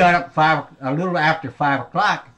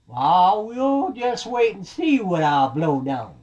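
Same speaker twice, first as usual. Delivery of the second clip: slow, raised, drawn-out threat; menacing big bad wolf; 6 semitones higher, slower than usual. Wolf threatening to blow the house down, slow menace. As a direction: stretch it out like a storybook wolf.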